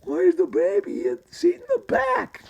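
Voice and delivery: extremely high squeaky falsetto; voice jumped high. Acted. Tunnel Rat asking about baby seat, voice jumped high.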